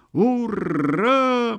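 long, high victorious hurrah; drawn-out triumphant yell; triumphant shout. A narrator's reading. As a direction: cheer it out in victory. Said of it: "победно закричали в другом месте".

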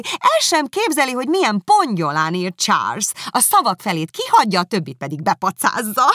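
magasabb, hangosabb, élénk gúnyolódás; harsányan árulkodik a bátyjáról; izgatott kiáltás. Acted. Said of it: "kiáltotta Miss Bingley". (magasabb, hangosabb, gúnyos kiáltás)

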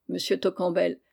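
usual speaking voice

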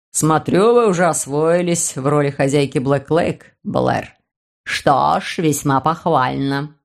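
put-on low, slow elderly gentleman; a sly old notary musing. Notary approves.

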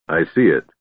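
ordinary delivery

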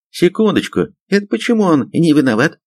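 high-pitched indignant objection. A narrator's reading. Major objects to Zemlyakov being cleared, indignant. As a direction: object in offended protest. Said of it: "возмутился попутный".

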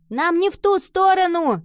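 high, loud cry of objection; shouted protest. A narrator's reading. Nova calls after Henry running the wrong way, shouting. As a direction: yell out that it's the wrong way. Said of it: "закричала Нова".

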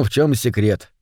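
ordinary delivery, nothing marked

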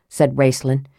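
ordinary delivery, nothing marked

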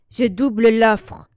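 loud, drawn-out urgent bid; feverish. A narrator's reading. Marcine desperate for the dog, loud and feverish. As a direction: raise the offer forcefully, as if fevered. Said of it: "comme saisie de la fièvre".

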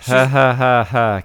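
loud spelled-out mocking laugh; ha-ha it out loudly.

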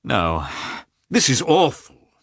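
exasperated husband, pitch jumping; exclaimed annoyance. A narrator's reading. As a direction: burst out irritably, then drag the last words. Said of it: "unable to restrain his annoyance".

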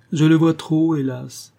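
dramatic exclamation of distress; anguished cry. A narrator's reading. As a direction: cry out, then sink on the last word. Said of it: "s'écria Béatrix".

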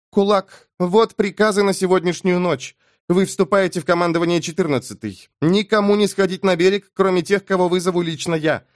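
adjutant giving orders. A narrator's reading. The adjutant gives Keneb tonight's orders, commanding woman's voice.

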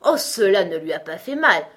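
loud, offhand dismissal; casual unicorn. A narrator's reading. Unicorn dismissing the king's worry, casual. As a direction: brush the concern aside lightly. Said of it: "déclara la licorne d'un air dégagé".

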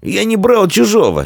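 high-pitched, agitated denial; nervous retort. A narrator's reading. Offender denying theft to his accuser, nervous retort. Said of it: "нервно отозвался обидчик".

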